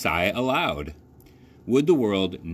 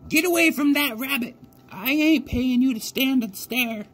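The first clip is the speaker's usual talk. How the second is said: put-on old country woman. A narrator's reading. Old lady tells the scarecrow rabbit he'll scare birds, high old voice. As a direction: sound like a rough old farm woman. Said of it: "said the old woman".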